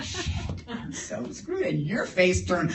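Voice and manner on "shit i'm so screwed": high, soft, scared whisper; whispered dread. Retold or imitated. Driver whispering dread as the cop turns him around. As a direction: breathe the curse in fear.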